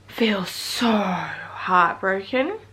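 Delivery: slow, breathy whisper of heartbreak; real heartbroken whisper. Real, not acted. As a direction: whisper it as if holding back tears. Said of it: "I'm still crying".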